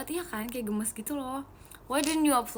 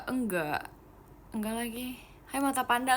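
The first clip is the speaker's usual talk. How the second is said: normal talking voice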